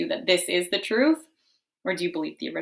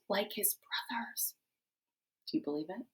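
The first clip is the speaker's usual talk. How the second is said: whisper; 12 dB quieter than usual. Acted. Aside to audience.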